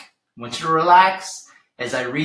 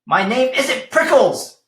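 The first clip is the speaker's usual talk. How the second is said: higher indignant mock yell; yelled back; 7 semitones higher than usual. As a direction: protest the nickname crossly. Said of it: "Hank yelled back".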